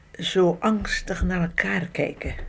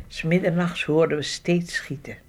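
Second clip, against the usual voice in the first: normal talking voice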